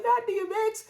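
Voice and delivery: high-pitched mock outcry at normal volume; imitated hollering. Viewers hollering over the D M X remark, mock outcry. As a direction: squeal it high, imitating the crowd. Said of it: "y'all was hollering".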